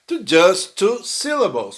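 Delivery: ordinary talk, nothing marked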